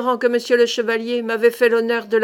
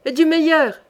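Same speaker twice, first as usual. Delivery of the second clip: higher, lightly raised voice; calling out; 5 semitones higher, 4 dB louder than usual. A narrator's reading. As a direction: call the order out, a touch louder. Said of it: "cria Passavant". (en criant un peu, voix plus aiguë)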